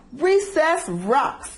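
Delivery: slow, high mock bellow; storyteller's light bellow. A narrator's reading. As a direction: draw out a big shark boast. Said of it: "bellowed Clark the shark".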